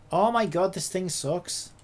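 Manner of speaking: usual speaking voice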